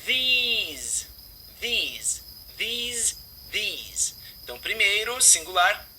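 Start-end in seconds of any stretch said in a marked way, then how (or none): 0.1-4.1 THESE with a drawn-out ee; THESE, long tense vowel, repeated as a drill. Shown as a demonstration. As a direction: stretch the ee in THESE. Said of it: "é um som longo".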